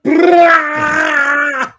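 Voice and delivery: loud high yell of "Brah!"; shout. Retold or imitated. Hunter yelling at a deer, loud high shout.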